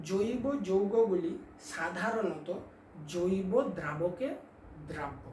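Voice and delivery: drawn-out words, "জৈব দ্রাবকে দ্রাব্য" spaced for writing; slow dictation. Shown as a demonstration. Teacher dictating a point for students to copy, slow and deliberate. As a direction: dictate it slowly, word by word. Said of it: "আস্তে আস্তে বলছি।".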